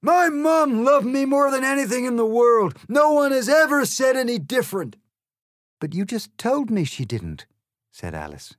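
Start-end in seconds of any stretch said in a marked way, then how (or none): 0.0-5.0 outraged yell. Acted. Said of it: "he yelled in outrage". 5.8-7.5 put-on a slightly higher, prim girl's voice; polite Alice, lightly voiced. Alice politely answers the egg, lightly voiced girl.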